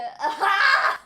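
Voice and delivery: loud disbelieving burst of laughter; reacting to the clip with a laugh; shocked laugh. Real, not acted.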